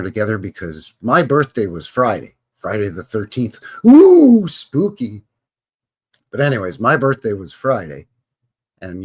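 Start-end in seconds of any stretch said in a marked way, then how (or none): 3.9-5.2 mock spooky. Birthday on Friday the thirteenth.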